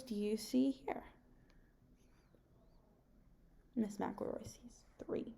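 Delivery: soft whispered question and answer. Acted. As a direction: whisper it as if sharing a secret with the kids. Teacher asks class, answers herself.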